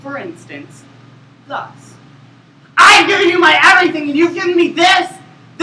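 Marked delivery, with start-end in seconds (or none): 2.8-5.6 much louder, higher, fast furious yell; shouting. Shown as a demonstration. Actor repeats the line yelling at full intensity. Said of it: "with the same amount of intensity".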